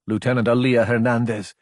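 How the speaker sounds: plain reading voice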